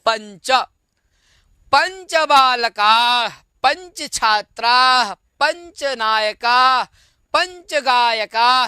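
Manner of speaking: slow, even recitation of each phrase; teacher's slow chanting drill. Shown as a demonstration. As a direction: chant it slowly for the class to repeat. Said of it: "जैसे पंच".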